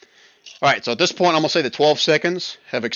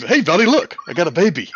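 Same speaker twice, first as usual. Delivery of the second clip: higher, louder playful character voice; lightly voiced Goda; 6 semitones higher, 5 dB louder than usual. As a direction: show off the baby in a slightly raised voice. Voices Goda showing off the baby.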